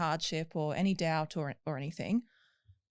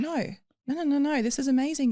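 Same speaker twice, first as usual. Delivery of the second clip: higher, louder proud dad; excited dad; 8 semitones higher, 5 dB louder than usual. Retold or imitated. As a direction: gush like a thrilled father. Dad voiced praising again.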